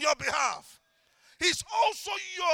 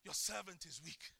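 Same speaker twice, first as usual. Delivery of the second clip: hushed, quieter prayer; soft pleading prayer; 6 semitones lower, 5 dB quieter than usual. Shown as a demonstration. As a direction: plead humbly, under the breath. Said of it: "I say".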